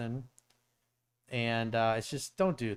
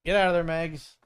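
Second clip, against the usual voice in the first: scolding dog; 5 semitones higher, 6 dB louder than usual. Real, not acted. Scolding puppy chewing wires.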